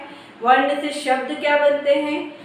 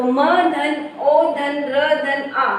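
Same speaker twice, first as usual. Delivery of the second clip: very slow, each letter spelled apart; drawn-out phonics breakdown; slow letter-by-letter spelling; much slower than usual. Shown as a demonstration. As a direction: spell it out slowly for children. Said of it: "मतलब म में ओ की मात्रा".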